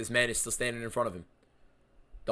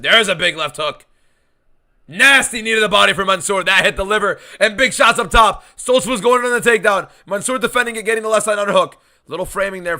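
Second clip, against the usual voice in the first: much higher and louder fight call; excited play-by-play; 7 semitones higher, 8 dB louder than usual. Real, not acted. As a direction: hype up every strike.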